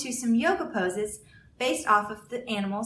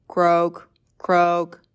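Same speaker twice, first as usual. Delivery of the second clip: low croaking call; frog croak; 5 semitones lower, 9 dB louder than usual. Acted. A teacher voicing Frog for children, low croak. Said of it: "said Frog".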